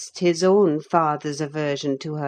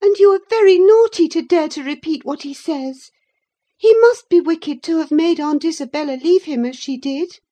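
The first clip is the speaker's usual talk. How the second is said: high, loud, quick scolding; indignant young Catherine; 12 semitones higher, 9 dB louder, faster than usual. A narrator's reading. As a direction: scold your cousin in a rapid girl's voice.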